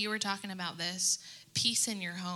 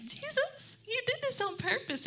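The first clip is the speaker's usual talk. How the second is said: mock complaint to Jesus. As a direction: whine it up at Jesus, half joking. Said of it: "because I'm like".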